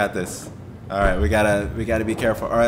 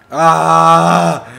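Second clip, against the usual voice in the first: loud, drawn-out moan; long dreading groan; 7 dB louder than usual. Real, not acted. Player who doesn't want to die anymore, dreading groan. Dreads dying and being shocked.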